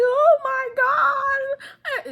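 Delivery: loud, slow, very high mock wail; mock crying. Recapper voicing the caught cheating woman, loud mock crying. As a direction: wail it like a hammed-up weeper. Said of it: "And she starts crying".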